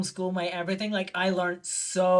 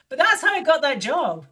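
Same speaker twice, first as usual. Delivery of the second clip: usual speaking voice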